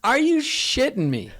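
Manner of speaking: high, slow incredulous whine; retold exasperated disbelief. No light signal, disbelief.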